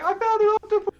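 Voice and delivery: very high, loud, imitating a thrilled man; mock thrilled. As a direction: squeal it out, overjoyed at the catch. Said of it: "he's like".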